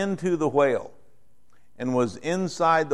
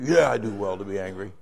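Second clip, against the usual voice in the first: put-on sulky child; 7 semitones lower than usual. Sulky child answers back.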